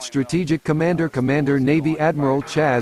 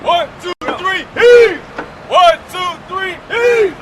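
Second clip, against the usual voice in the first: shouted count, high and loud; gruff dock worker hollering; 5 dB louder than usual. Real, not acted. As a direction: bellow the count to haul together. Group hauling, calling the count.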